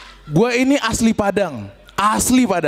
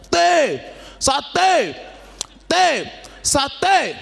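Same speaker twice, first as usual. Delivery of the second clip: street vendor call; 6 semitones higher, much slower than usual. Retold or imitated. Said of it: "sambil teriak-teriak".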